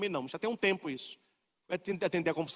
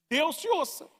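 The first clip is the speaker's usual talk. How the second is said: Deus te ouça, lifted higher on te with a falling end; higher repeat; 8 semitones higher than usual. Shown as a demonstration. Preacher echoing his own retort, lifted higher and stressed.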